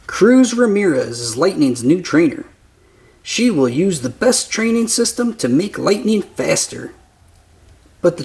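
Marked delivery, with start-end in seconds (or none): none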